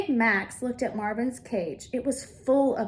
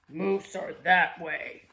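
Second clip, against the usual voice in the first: lower, slow bear voice; roaring bear; 5 semitones lower, slower than usual. Acted. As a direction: rumble it like a big bear. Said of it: "roared the bear".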